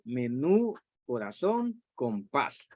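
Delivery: "menú, corazón, compás", each stressed on its final syllable; last-syllable stress. Shown as a demonstration. Teacher giving examples of agudas, last-syllable stress. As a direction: list "menú, corazón, compás" one by one, weight on each ending. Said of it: "la fuerza está en la última sílaba".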